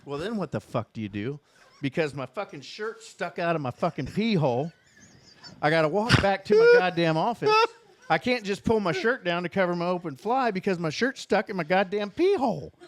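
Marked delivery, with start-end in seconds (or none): 5.6-12.7 laughing through the words; talking through laughter at his own story; laughing while telling the story. Real, not acted. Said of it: "laugh heard".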